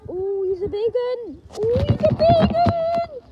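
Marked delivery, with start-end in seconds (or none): 1.5-3.2 much higher, louder, slow drawn-out awe; playful awestruck repeat of big one; wonder. Pretend. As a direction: stretch it out in amazed wonder.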